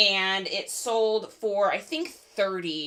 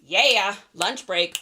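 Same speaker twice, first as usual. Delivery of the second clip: normal talking voice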